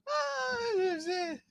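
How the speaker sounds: high, breathless laughter-squeezed words; can barely talk for laughing; real helpless laugh. Real, not acted. Overcome laughing at dog.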